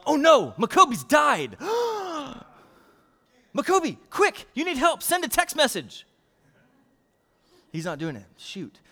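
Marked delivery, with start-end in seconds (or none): 0.1-1.6 loud, hammed-up alarm; mock alarm. Pastor pretending his volunteer has died, loud mock alarm. 1.6-2.4 high, hammed-up gasp; mock gasp. Pastor gasping at the pretend-dead volunteer. 3.6-6.1 high, hammed-up urging; mock urgency. Pastor urging the pretend-dead boy, high mock panic.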